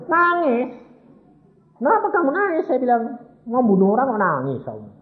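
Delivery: high, loud astonished quote; incredulous questioning. Retold or imitated. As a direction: act out disbelief at the tears. Retold astonishment at crying gunman. (bertanya heran dengan nada tinggi)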